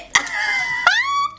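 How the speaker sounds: high, squealing laugh at herself; real high laughing squeal. Real, not acted. Laughing at own outfit.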